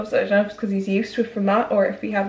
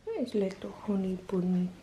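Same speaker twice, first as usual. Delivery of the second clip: soft puppy talk; 7 dB quieter than usual. Real, not acted. Greeting new puppy softly.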